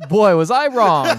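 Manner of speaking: loud, slow, high laughing exclamation; laughing admission. Real, not acted. Co-host admits misjudging the movie to his friend, laughing admission. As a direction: belt it out through real laughter.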